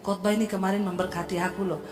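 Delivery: soft sincere comfort; gentle advice. Shown as a demonstration. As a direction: speak kindly to a hurting friend. Model gentle reply to friend.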